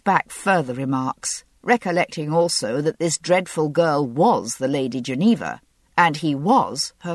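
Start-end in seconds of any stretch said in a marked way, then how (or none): none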